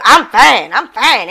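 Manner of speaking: clipped off, irritated; a mock snappy brush-off; curt angry reply. Curt reply while mad.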